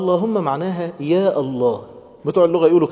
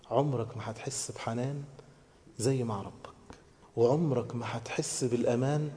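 soft, lowered voice with long pauses; tender; 3 semitones lower, 6 dB quieter than usual. Real, not acted. Preacher telling youths of God's tenderness, hushed and tender. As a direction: speak low and softly, as if sinking into an embrace. Likening prayer to an embrace.

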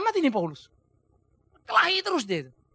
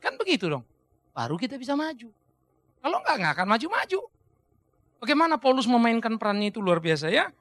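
ordinary delivery

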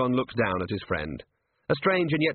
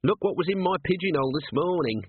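lightly voiced scruffy little watchman, higher, complaining; Nobby's voice; 8 semitones higher than usual. A narrator's reading. Nobby grumbling to the sergeant, a higher whiny voice. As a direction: whine it up a bit like a grumbling runt.

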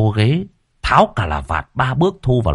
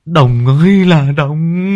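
despairing lament; 3 semitones higher than usual. A narrator's reading. Breathless, wrecked victim lamenting.

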